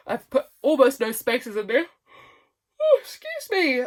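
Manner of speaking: talking through a real yawn. Real, not acted. Reading her cramped journal writing, yawning as she talks. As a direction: let the yawn swallow the words.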